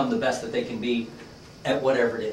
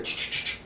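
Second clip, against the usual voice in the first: unvoiced clicking of guns; quick "ch-ch" weapon noises; imitated rifle sounds; 8 dB quieter than usual. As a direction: mimic rifles being readied, softly. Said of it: "almost hear the guns being aimed".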